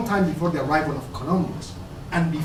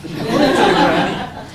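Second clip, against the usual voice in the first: laughing; 4 semitones higher, 9 dB louder than usual. Real, not acted. Laughs while explaining himself.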